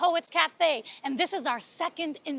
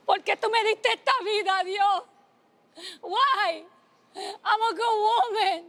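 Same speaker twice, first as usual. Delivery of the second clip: higher, tearful pleading lament; mother crying to God; 5 semitones higher than usual. Acted. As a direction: cry out to God in despair. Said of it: "she started crying".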